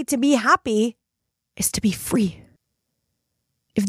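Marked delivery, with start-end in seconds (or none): 1.6-2.4 whispered, softer close; hushed whisper. Real, not acted. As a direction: drop to a soft whisper. Soft emphatic close of a point.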